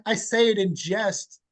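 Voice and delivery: ordinary talk, nothing marked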